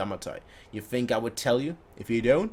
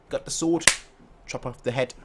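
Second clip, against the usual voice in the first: ordinary talk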